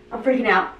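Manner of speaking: ordinary talk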